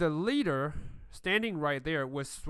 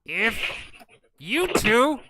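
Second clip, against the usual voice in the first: higher, louder warning snapped out; angry threat; 8 semitones higher, 10 dB louder, slower than usual. Acted. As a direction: bark the threat at the crawlers. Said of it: "And he says".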